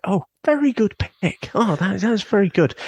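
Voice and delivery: high-pitched imitation of overly polite guests; mock polite. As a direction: put on a very high, polite little voice. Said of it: "they were so polite".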